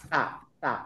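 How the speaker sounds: তা said with the mouth wide open. Shown as a demonstration. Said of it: "যদি মুখটা আমি খুলে দেই".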